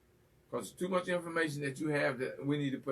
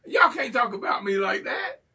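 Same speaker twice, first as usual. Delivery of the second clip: half-laughing mock cry, louder; mock wounded shout; 4 semitones higher, 7 dB louder than usual. Host protests the teasing with a pretend sob. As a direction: play hurt, loud and teasing. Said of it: "I'm gonna cry".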